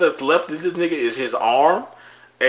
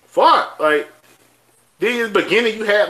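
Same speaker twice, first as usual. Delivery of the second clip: ordinary delivery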